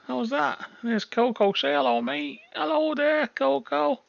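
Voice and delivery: high, sing-song voice for the little dog; talking to the dog. Real, not acted. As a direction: coo at the dog like a pet owner. Showing his dog to friends.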